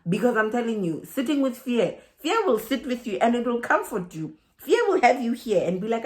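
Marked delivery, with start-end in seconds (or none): none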